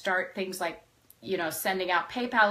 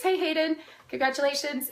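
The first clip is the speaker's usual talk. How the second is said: high-pitched, cheery congratulations; congratulatory shout-out; 7 semitones higher than usual. Real, not acted. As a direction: call out a high, happy well-wish. Congratulating someone mid-talk.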